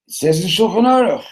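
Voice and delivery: high, loud insistence; indignant citing. Acted. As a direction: insist on the written law. Newcomer cites law in protest.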